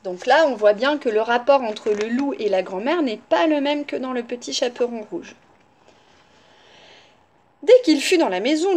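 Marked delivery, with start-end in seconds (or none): none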